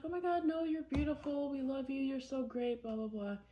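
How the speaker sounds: high, gushing reassurance; sing-song fake comfort; gushing friends voice. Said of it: "they'd be like".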